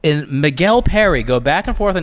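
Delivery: mock news headline, slow and announcer-like; news read.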